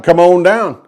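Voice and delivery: normal talking voice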